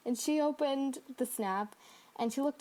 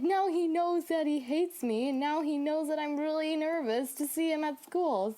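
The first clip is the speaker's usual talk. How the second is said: upset retort; 6 semitones higher than usual. Retold or imitated. Girl snapping back at reassuring friend, exasperated. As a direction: throw the words back at her in frustration.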